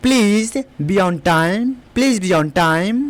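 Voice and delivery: very slow, careful English; slow classroom dictation pace; slow model sentence. Shown as a demonstration. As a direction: spell each word out slowly for learners. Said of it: "इस type से बोलना है।".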